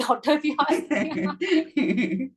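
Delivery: said through a real laugh; chuckling while adding the aside. Real, not acted. Laughing aside about her daughter.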